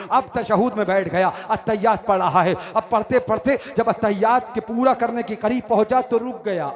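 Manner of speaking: loud, driving declamatory narration; march through each step of the story with rising force; punchy preacher's recounting; sermon storytelling. A narrator's reading.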